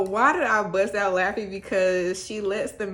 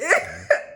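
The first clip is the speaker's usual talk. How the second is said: short laugh; 12 semitones higher, 5 dB louder than usual. Real, not acted. Laughs at quote.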